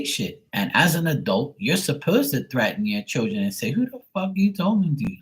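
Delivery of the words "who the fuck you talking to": put-on high-pitched indignant challenge to a child; raised-pitch mock outrage; mock parental scolding. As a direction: imitate a parent telling off a mouthy kid.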